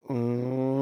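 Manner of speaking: long droning cow impression; uncanny cow moo. Shown as a demonstration. Cow impression on request, uncannily realistic moo. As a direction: moo slowly like a real cow. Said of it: "Now that's uncanny".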